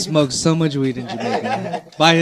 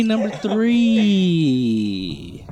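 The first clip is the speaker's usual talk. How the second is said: slow, stretched "number three"; drawn out; 4 semitones higher, much slower than usual. Real, not acted.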